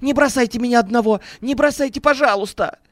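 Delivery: high, desperate pleading; crying out. A narrator's reading. Businessman begs not to be left alone, crying out desperately. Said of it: "выкрикнул Леонид Владимирович".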